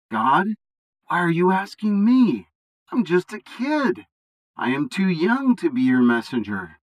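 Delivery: a frightened youth protesting to God; young Jeremiah. Acted.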